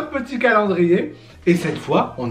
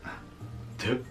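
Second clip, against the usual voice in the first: normal talking voice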